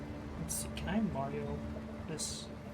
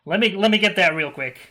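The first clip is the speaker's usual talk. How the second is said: loud; 6 semitones higher, 16 dB louder than usual. Real, not acted. Hurrying to grab item.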